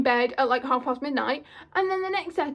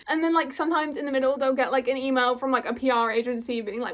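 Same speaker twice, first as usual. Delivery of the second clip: normal talking voice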